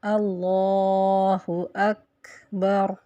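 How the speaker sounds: slow recitation of اللہ اکبر with a puff on the ک; recited takbir. Shown as a demonstration. Teacher reciting اللہ اکبر slowly to show the ک. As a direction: draw out اللہ اکبر, breathing out the ک. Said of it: "جیسے".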